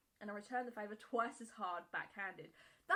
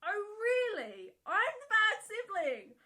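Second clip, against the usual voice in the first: high, loud sarcastic snap; snapped; 10 semitones higher, 11 dB louder than usual. Retold or imitated. Brother snapping at his sister, high and loud. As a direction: snap back with scornful laughter. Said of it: "I snapped and said".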